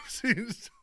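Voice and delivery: high laughing echo. Real, not acted. Mocking the dizzy partner.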